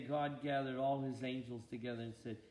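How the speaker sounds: ordinary delivery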